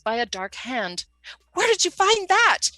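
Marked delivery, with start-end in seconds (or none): 1.5-2.7 high flustered squeak. Acted. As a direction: caught out, voice jumps up. Flustered, caught out.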